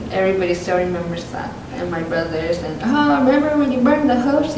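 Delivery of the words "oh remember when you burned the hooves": higher, louder mock teasing; teasing brother. Brothers teasing her about burning the hooves, higher mock teasing. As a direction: rib her about the old mishap.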